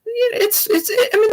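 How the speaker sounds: high squeaky unimpressed voice. Retold or imitated. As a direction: squeak out a lukewarm verdict. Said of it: "I was like".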